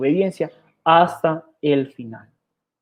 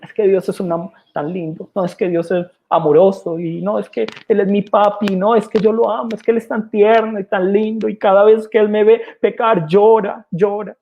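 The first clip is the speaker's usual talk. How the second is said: sugary, higher mock-sweet voice; mocking shallow believer; 5 semitones higher than usual. Preacher mocking the congregation's cute view of God, sugary parody. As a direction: parody a naive gushing believer. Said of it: "Como decimos".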